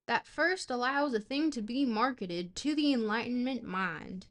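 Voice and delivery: trying on a Southern US accent. Shown as a demonstration. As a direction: read philosophy with a Southern twang. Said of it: "we can try a Southern accent".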